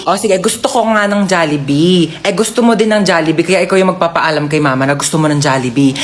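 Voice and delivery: ordinary delivery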